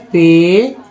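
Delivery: पेशेंट said as pay-shent, with sh for ti; model pronunciation. Shown as a demonstration. Teacher reveals how students should say patient. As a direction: say पेशेंट with a sh sound in the middle, firmly. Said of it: "उच्चारण क्या करते हैं".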